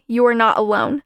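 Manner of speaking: normal talking voice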